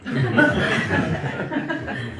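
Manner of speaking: high, breathy burst of laughter; real incredulous laugh. Real, not acted. Laughing at the politician's astonishing answer, incredulous laugh.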